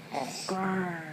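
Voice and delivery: low, soft rolling growl; bear growl. Pretend. Parent naming the bear for a child, low soft growl. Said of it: "That's bear".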